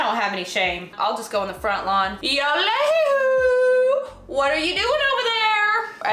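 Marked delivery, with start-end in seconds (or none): 2.2-6.1 high, loud shouted greeting; calling out. Acted. Nosy neighbor hollering across the street, calling out.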